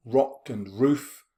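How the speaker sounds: slow, deliberate rock and roof, leaning on the r sounds; alliteration read out slowly. Shown as a demonstration. Teacher pointing out alliteration, said slowly. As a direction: lean on the matching first sounds. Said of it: "Then we've got the alliteration".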